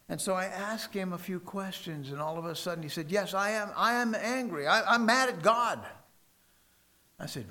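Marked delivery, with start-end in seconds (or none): 3.1-5.8 rising, louder confession of anger; angry man. Retold or imitated. Caller admits to the preacher he's mad at God, building anger. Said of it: "he was an angry man".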